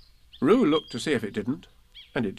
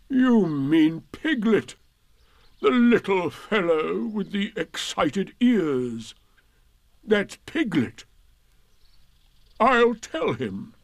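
slow gloomy donkey voice; Eeyore voice; 8 semitones higher, 3 dB louder, much slower than usual. Acted. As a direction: drag it out, gloomy and glum.

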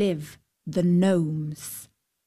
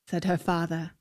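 plain reading voice

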